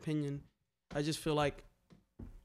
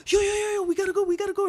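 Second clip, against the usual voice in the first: high and loud, urgent repeated alarm; panicked warning; 7 dB louder, much faster than usual. Retold or imitated. Girl warning the crew to run, panicked high and loud. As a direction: sound the alarm fast before the cops come. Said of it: "she's like".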